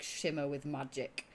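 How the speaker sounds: usual speaking voice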